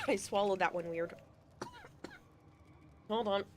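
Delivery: choked and coughing; coughing up water. Real, not acted. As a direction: talk through a cough. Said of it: "went down the wrong pipe".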